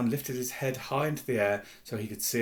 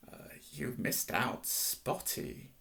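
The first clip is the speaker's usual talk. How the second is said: soft, unvoiced, slow taunt; hissed; 6 dB quieter, much slower than usual. A narrator's reading. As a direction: hiss it spitefully into her ear. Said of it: "Peeves hissed in her ear".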